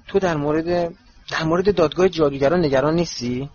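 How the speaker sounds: ordinary delivery, nothing marked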